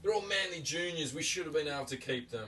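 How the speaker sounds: high whining fan voice; whiny rival fan. Retold or imitated. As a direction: play a sulky fan making excuses. Voicing an arguing rival fan.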